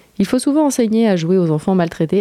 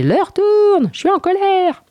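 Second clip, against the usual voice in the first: mock angry shout; slower than usual. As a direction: shout it in playful pretend rage. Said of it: "Je criai".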